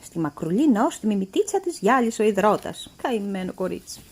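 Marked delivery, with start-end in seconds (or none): none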